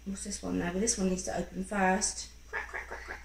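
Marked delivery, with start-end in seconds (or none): 2.5-3.2 whispered crack. Acted. Egg cracking noise.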